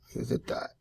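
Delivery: soft unvoiced whisper, to himself. Real, not acted. Art teacher whispering while drawing the leg. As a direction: murmur the label under his breath as he draws. Drawing lesson, repeating a label.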